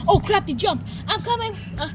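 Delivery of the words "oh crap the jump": usual speaking voice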